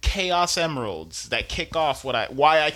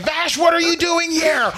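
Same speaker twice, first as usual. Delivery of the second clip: high, loud dramatic cry; mock shocked shriek; 7 dB louder than usual. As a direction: shriek it like a soap opera. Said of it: "She's like".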